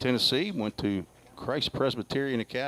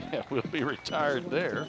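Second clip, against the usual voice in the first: laughing; 3 semitones higher, 3 dB quieter than usual. Real, not acted.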